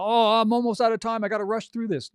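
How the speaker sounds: hammed-up stressed outburst; mock panic. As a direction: act out being pressed for time. Acts out a pressured author in studio.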